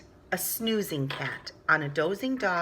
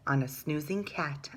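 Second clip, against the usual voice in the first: plain reading voice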